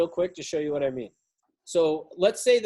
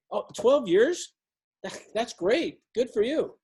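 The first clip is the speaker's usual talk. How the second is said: higher, slow, impressed question with a laugh; like you mean it; 4 semitones higher, slower than usual. Shown as a demonstration. Coach showing the right way to affirm an answer, excited. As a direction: react as if genuinely impressed. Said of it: "I don't just go".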